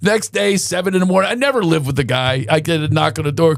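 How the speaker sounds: normal talking voice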